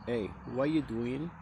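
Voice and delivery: ordinary talk, nothing marked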